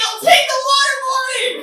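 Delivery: high, loud comic shout; husband's mock shout. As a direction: yell it like a desperate husband. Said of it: "He's like".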